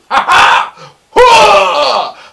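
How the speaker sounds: a sudden, high, loud burst of laughter; loud laughing shout. Real, not acted. Big laugh at sixteen hours.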